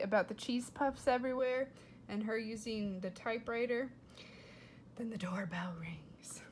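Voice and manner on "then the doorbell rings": soft, breathy, unvoiced; hushed suspense; whisper. Real, not acted. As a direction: whisper it like a secret. Whispered story aside.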